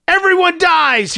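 high, drawn-out scream of fury; screamed threat. A narrator's reading. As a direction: scream it slowly, stretching the words. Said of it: "he screamed".

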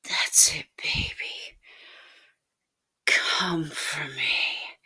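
lightly whispered coaxing; breath-led, encouraging near-whisper; intimate whisper. A narrator's reading. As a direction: murmur close to the ear, unhurried. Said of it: "you whispered in my ear".

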